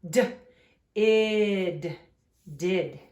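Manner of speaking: "D, id, did" built sound by sound. Shown as a demonstration. Teacher sounds out did for the class. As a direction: break "did" into D and id, then blend. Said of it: "I can sound it out".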